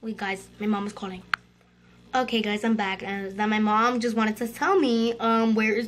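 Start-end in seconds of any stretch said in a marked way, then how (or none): none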